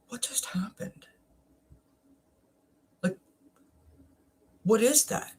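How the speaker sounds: a hushed what-just-happened whisper; retold whisper. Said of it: "And I was like".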